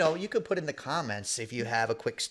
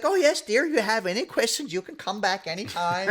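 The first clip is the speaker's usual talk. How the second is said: much higher, sugary mock granny voice; sweet old lady; 8 semitones higher than usual. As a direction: coo it like a recruiting grandma. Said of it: "she's like".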